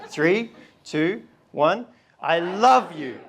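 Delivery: shouting to nan who is hard of hearing; shouting to a deaf nan. Shown as a demonstration. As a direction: bellow it so grandma hears. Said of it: "your nan's ninetieth birthday party and she is hard of hearing".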